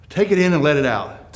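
breathing in and sighing out; deep breathing. Shown as a demonstration. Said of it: "Start with some deep breathing".

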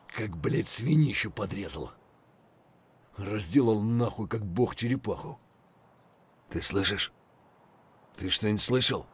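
hushed, breathy whisper throughout; whispered lines. Acted. Soldier sneaking behind enemy lines, whispering. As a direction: keep your voice down, stay hidden.

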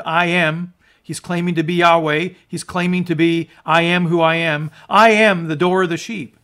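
fervent preaching. Acted. Preacher proclaiming Jesus's I am sayings, fervent and loud. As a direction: proclaim it to the whole room.

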